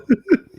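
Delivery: short loud squeal of a laugh; a sudden shrieking laugh; high burst of laughter. Real, not acted. Reacting to the prank call.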